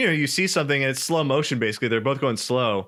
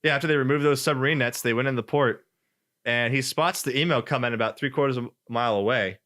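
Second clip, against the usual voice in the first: normal talking voice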